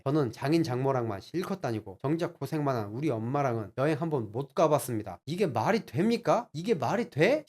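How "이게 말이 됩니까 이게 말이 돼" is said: higher, slower, disbelieving protest; exasperated outrage. Retold or imitated. Husband protesting his mother's neglect, exasperated. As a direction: demand an answer, incredulous. Husband venting about unfair treatment.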